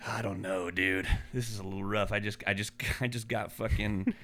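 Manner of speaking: a hint of a queasy customer, said under the breath; quiet dismay. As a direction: mutter it like you've just seen too much. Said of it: "And I was like".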